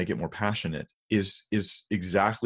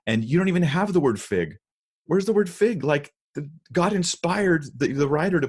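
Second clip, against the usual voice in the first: incredulous; 8 semitones higher than usual. Real, not acted. Pastor objecting to a missing word, incredulous and raised. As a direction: hit it with outraged disbelief.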